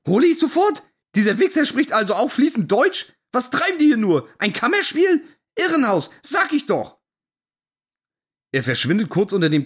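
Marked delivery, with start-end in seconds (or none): 0.1-0.8 "Hole ich sofort" echoed much higher, in disbelief; outraged disbelieving echo of the waiter; incredulous. Retold or imitated. 1.2-7.0 higher-pitched, exasperated ranting; mock-outraged tirade at the waiter; indignant rant.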